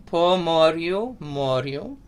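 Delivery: "po moryu, moryu" slowly, with rolled R's; Ukrainian pronunciation. Shown as a demonstration. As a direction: model "moryu" slowly, rising at the end. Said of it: "So say that with me".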